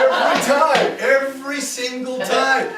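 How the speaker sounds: high, loud and drawn out; exaggerated emphasis. Son joking about his dad's predictable reply, loudly exaggerated. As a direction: hammer it out in comic overstatement.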